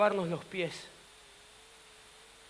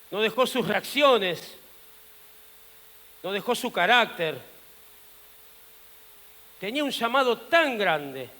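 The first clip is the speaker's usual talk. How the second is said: usual speaking voice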